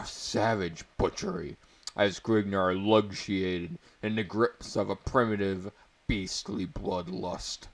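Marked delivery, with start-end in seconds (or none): none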